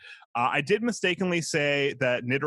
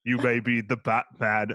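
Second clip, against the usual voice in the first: slow, tender Cockney butler voice; Alfred telling Bruce he loves him, in a Cockney accent; Michael Caine impression; slower than usual. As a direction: lay a Cockney accent on thick, slow and fatherly. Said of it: "That's Michael Caine".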